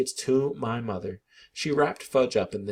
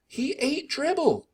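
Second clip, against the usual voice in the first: much higher, slow, insistent; insisting; slower than usual. A narrator's reading. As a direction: press the point, he won't be doubted. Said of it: "I insisted".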